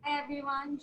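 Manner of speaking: ordinary talk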